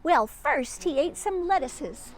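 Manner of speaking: bright storybook read-aloud; reading to children. A narrator's reading. Reading Peter Rabbit aloud to a child, storybook voice. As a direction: tell it like a bedtime story for a child.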